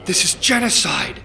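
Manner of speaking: loud, high accusation; outraged shout. Acted. Commander accuses the major of genocide, outraged. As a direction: hurl it at him.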